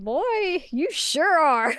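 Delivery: put-on high swoop on "Boy", then drawn out; mock-knowing, stretched-out comeback; sassy retort. Teller's sassy retort to character.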